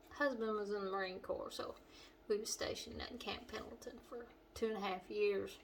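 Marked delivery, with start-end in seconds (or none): none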